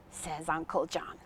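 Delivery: ordinary delivery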